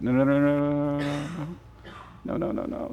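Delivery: hushed string of quick repeated refusals; gentle interruption. Acted. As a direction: hush the worrier with soft repetition. Cuts off acted self-reproach, soothing.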